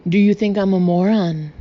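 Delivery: very loud scornful shout. A narrator's reading. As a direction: yell back at once, mocking. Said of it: "Gaston immediately shouted".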